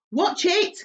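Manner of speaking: high, loud sudden warning; warning cry. Acted. Miss Spider warning as the room lurches, crying out. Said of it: "cried Miss Spider".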